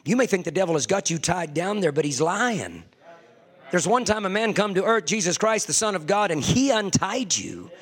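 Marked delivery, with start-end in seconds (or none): none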